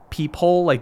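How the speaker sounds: ordinary delivery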